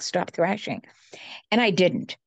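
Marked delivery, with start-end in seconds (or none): 0.0-0.8 a soft, matter-of-fact instruction. Retold or imitated. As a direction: say it simply, like remarking on a pretty flower. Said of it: "just so matter of fact".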